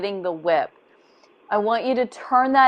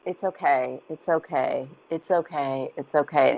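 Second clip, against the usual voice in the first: low, soft, rocking reassurance; softly soothing self-talk; soothing self-comfort; 5 semitones lower, 6 dB quieter than usual. Retold or imitated. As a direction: hush yourself gently like rocking a child. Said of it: "rock myself".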